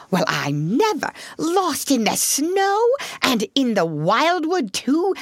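astonished fussing with high swoops; changed, welcoming voice. A narrator's reading. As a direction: usher them in with amazed concern. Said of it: "in quite a different voice".

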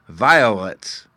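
put-on a boy deciding; considered answer. A narrator's reading. Fairy boy answering after thinking, childish. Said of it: "he said at last".

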